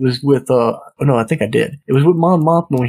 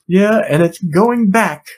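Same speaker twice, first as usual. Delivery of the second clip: higher, slow, pointed comeback; retold huffy retort; 6 semitones higher, slower than usual. Retelling the wife walking out on her husband, mildly huffy. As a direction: imitate a huffy parting shot, drawn out.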